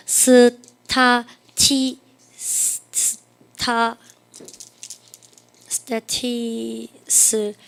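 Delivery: very slow dictation, long gaps between syllables; sounding out syllables. Shown as a demonstration. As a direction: say each syllable separately, as if writing it out. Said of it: "발음하면서 외워줘".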